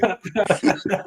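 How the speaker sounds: high burst of laughter; real laugh at a joke. Real, not acted. Laughing at the parody.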